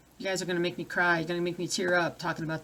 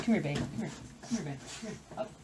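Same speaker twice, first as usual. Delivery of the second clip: coaxing dog; 10 dB quieter, faster than usual. Real, not acted. Owner quietly coaxing her dog to come. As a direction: gently coax the dog over.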